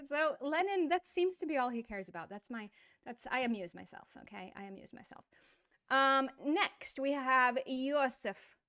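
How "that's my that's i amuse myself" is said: ordinary talk, nothing marked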